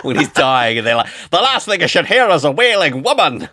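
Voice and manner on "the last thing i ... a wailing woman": high, loud, hammed-up Scottish accent; bad Scottish accent. Dying man complaining about a wailing woman, bad Scottish accent. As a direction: put on a terrible Scottish accent, pitched way up. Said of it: "that sounds like a really bad Scottish accent".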